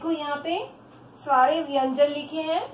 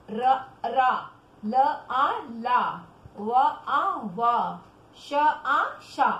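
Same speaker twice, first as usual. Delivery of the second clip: very slow syllables, each vowel drawn out; pronunciation drill; 2 semitones lower, much slower than usual. Shown as a demonstration. Teacher drilling children on the barakhadi, slow pronunciation demo. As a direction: chant out letter, vowel, then the joined syllable. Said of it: "पहले उच्चारण करो".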